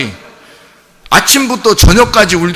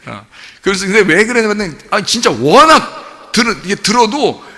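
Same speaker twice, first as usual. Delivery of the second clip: usual speaking voice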